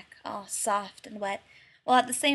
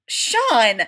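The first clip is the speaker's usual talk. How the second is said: indignant correction; 11 dB louder than usual. A narrator's reading. Shawn's name, Gus's correction.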